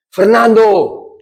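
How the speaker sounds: long drawn-out call across the cellblock. Retold or imitated. Prisoner calling the speaker from another cellblock, drawn-out call. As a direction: stretch the name out to reach far.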